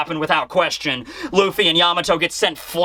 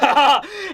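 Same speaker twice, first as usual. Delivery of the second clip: higher-pitched mock cackle; acted laugh; 7 semitones higher than usual. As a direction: laugh it out in character. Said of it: "It's like".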